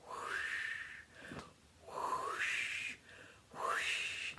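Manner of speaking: whispered whoosh of dragon wings. Acted. Grandma making the dragon's wing sound for the kids, whispered whoosh. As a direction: breathe out the sound of wings beating.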